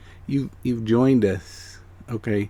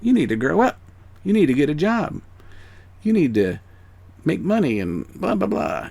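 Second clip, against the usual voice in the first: higher-pitched mock scolding of a dreamer; mimicked dismissive adults; 6 semitones higher than usual. Adults telling a kid to get a job, mock scolding. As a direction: lightly imitate adults dismissing a child's dreams. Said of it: "The adults in your life say".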